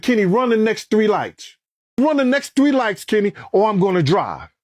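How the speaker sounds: high, pressing command to the driver; urgent order. Retold or imitated. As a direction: push him to floor it, voice up high. Ordering driver during danger.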